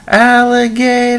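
shrieked high and drawn out; mock panic cry; 1 high, slow panicked yell. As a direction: yell it like you've spotted a gator. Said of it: "all of a sudden".

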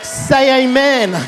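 loud, driving call-and-response preacher; rally the room with rising, punched exhortations; full-force preaching. Real, not acted. Pastor urging the congregation to say amen, full-force preaching.

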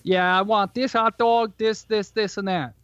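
put-on quick Chicago accent order. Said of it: "you went into your Chicago accent".

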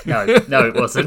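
loud, laughing protest; laughing denial. Real, not acted. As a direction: deny it through a burst of laughter. Laughing denial amid shared laughter.

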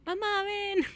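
high, loud mock yell; yelling for mom. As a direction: call out for your mother from afar. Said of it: "le grito".

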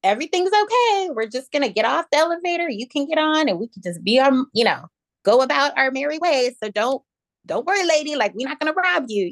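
high, reassuring tone for a scared stranger; reassuring the woman. Retold or imitated. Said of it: "And I was like".

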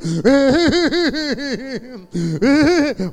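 high, loud burst of laughter. Real, not acted. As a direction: let out a big laugh. Preacher laughs loudly.

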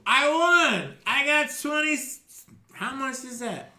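put-on high, loud drunken cheer; drunken yell. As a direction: shout it like a sloppy winner. Drunk Skruldar cheering his roll.

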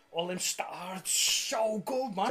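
normal talking voice

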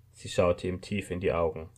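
ordinary delivery, nothing marked

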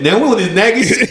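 high mocking nag; mocking nagging voice. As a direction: whine it like a nagging partner. Mimicking a nagging partner.

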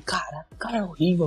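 hushed, breathy aside about the boss; whisper. Retold or imitated. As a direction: whisper it so the boss won't hear. Venting about the boss privately. (sussurrando sobre o chefe)

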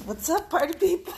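upbeat hello to the crowd; cheerful show opener; excited greeting. Real, not acted.

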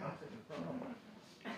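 hushed inner voice; a private whisper of delight; whispered thought. Retold or imitated. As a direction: whisper it to yourself, proud and secret. Inner thought while sitting down.

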